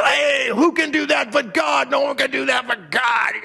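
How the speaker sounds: high, put-on grumbling voice; grumpy. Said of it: "they're all grumpy".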